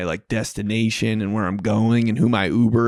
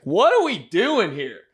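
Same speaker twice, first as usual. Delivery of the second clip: higher, bewildered tourist whine; mock bewildered traveler, higher voice; confused traveler; 9 semitones higher than usual. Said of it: "I've been this person before".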